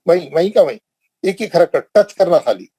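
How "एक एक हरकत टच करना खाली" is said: ordinary talk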